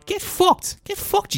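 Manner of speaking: shrill high mock jeer. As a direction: squeal it out in derision. Mocking the complainers.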